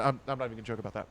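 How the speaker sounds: ordinary talk, nothing marked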